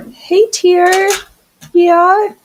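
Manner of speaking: loud, high pet-talk greeting; calling to the cat. Real, not acted. Greeting the cat who came back, loud high call.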